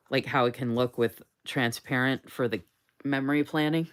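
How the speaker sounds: ordinary delivery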